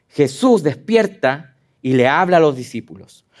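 ordinary delivery

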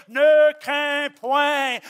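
very slow and loud, each word drawn out; proclaimed command. Acted. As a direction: ring it out like a prophet, slowly. Quotes the Bible, proclaiming.

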